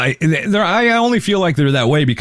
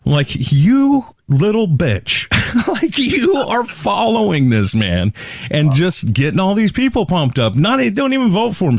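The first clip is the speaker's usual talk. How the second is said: incredulous outburst; 9 semitones higher than usual. Real, not acted. Outraged rant at vendor.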